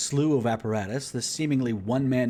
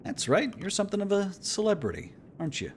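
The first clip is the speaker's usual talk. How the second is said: higher, teasing officer; lightly voiced ribbing officer; 11 semitones higher than usual.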